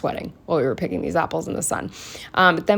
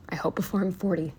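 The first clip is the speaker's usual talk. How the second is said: ordinary talk, nothing marked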